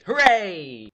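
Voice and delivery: normal talking voice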